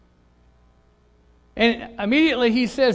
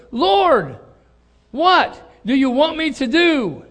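trembling, astonished; 7 semitones higher, 7 dB louder, slower than usual. Retold or imitated. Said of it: "he's trembling and astonished".

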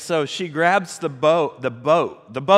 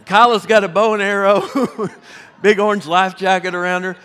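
laughing louder while describing; 3 semitones higher, 5 dB louder than usual. Real, not acted. Pastor laughing as he describes the soaked kids. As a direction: giggle through the picture.

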